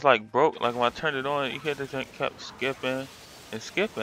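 ordinary talk, nothing marked

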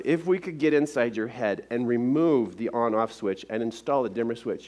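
ordinary delivery

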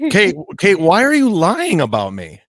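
angry caller confronting Kate on air, loud; loud accusation. Real, not acted. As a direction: confront her loudly, hurt and angry.

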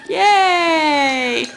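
high, happy cheer; excited cheer. Real, not acted. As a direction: cheer the dog on. Cheering the dog.

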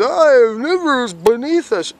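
high, loud mocking whiny voice; mocking quote. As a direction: parody a know-it-all in a squeaky voice. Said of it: "this guy says".

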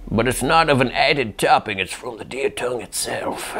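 lightly voiced old man. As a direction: read it as an elderly man. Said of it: "He's requesting an old man voice".

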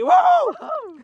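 short, high cheer; excited whoop. Real, not acted. Cheering his son's catch in the net, a high whoop. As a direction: whoop as the fish hits the net.

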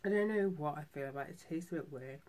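chewing while talking; speaking around food; mouth partly full. Real, not acted. Seen 0: Bitten mochi in hand, mouth still working early, then shown.

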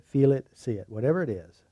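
usual speaking voice

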